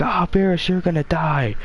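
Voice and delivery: hammed-up high villain taunt; high mock threat. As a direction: toss the threat off like a cocky fighter. Vegeta threatening Beerus in fight.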